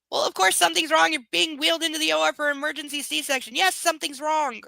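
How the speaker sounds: fast, loud, high-pitched sarcasm; loud, rapid-fire disbelief; exasperated rant. Real, not acted. Reader rants at the obvious.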